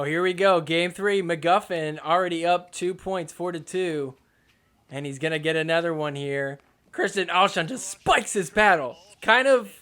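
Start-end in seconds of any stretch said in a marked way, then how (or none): none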